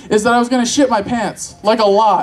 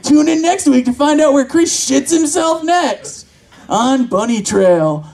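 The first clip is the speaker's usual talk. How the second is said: TV teaser announcer; 3 semitones higher than usual. Acted. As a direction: sell next week's episode, stretching the show title. Mock TV show teaser.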